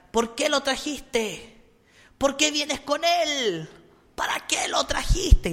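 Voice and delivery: high, drawn-out demonic wail; possessed girl screaming. Retold or imitated. Priest voices a possessed girl at an exorcism, high drawn-out cry. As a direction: slowly cry out in a high accusing voice. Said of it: "empezaba a gritar".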